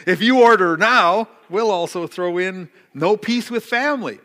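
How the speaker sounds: loud mock TV-ad promise, voice up; hammed-up commercial offer, joking; infomercial pitch. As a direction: sell it like a cheesy shopping channel host. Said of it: "it sounds like one of those, uh, commercials".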